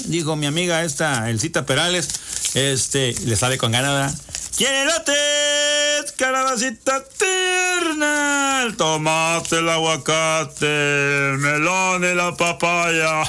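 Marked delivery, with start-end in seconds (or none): 4.6-13.2 slow, drawn-out market cry, half shouted; street vendor call. Retold or imitated. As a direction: hawk vegetables like a street peddler.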